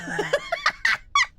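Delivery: high squealing laugh; shrieking laugh. Real, not acted. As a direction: burst into a shrill laugh. Laughs after shout.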